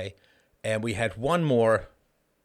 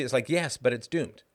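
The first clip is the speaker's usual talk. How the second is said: normal talking voice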